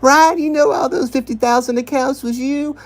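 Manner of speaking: high sarcastic parody of the accuser; mocking Lindy. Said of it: "And she's like".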